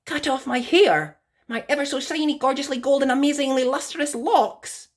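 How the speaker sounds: high, aghast questions; shocked disbelief. Acted. Rapunzel horrified at cutting her hair, high and aghast. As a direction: gasp at the idea, clutching your hair.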